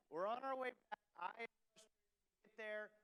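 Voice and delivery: ordinary talk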